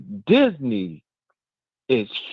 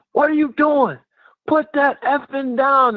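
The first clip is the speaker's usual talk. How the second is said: retold screaming; 10 semitones higher than usual. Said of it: "somebody screaming".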